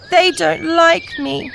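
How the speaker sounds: 1 mild sob. Acted. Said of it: "sobs the duckling".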